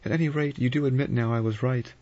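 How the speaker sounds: high, insistent exclamation; crying out. A narrator's reading. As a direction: press the point, pitched right up. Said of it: "Vera cried".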